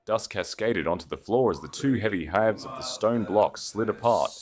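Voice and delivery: plain reading voice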